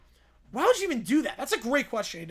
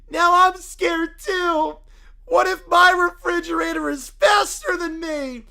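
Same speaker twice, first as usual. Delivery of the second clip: scared shout; 2 semitones higher, 8 dB louder than usual. Acted. Fear spreads among kids.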